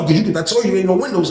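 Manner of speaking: usual speaking voice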